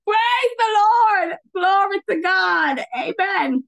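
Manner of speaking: high, drawn-out shout of praise; exultant praise. Real, not acted. Preacher praising God after the interruption, exultant shout. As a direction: call out praise, stretching the words.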